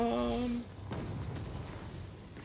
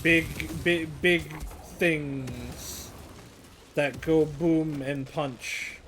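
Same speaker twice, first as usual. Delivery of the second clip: slow, playful drawl; much slower than usual. Acted. Playful description of big machines.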